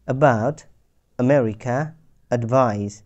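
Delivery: about, আমেরিকা, অ্যাডভাইস with a short weak a; short throat a, no stress; unstressed schwa. Shown as a demonstration. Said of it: "আ হচ্ছে সংক্ষিপ্ত এবং গলা থেকে উচ্চারণ করেছি".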